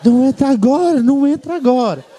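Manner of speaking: lightly voiced dogs pleading a warning; dogs' warning. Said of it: "Parecia que eles estavam tentando me avisar".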